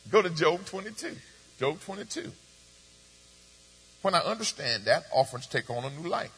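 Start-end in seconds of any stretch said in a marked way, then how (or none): none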